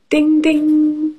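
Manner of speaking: high sing-song ding ding; chiming ding ding. Acted. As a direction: chime it like a bell. Page-turn chime sound.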